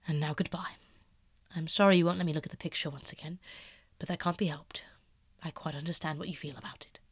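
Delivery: lightly voiced painter, half-hushed and earnest. Basil confessing to Dorian, hushed and earnest.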